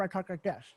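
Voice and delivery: softly garbled, tongue-tied speech; tongue-crusher speech. Shown as a demonstration. Showing how the tongue crusher made me talk, garbled. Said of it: "I had a tongue crusher".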